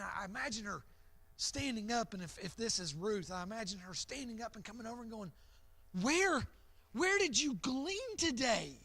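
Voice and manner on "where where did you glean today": amazed Naomi. Acted. Naomi voiced amazed at harvest.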